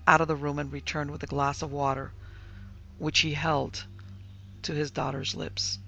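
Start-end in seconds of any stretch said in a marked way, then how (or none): none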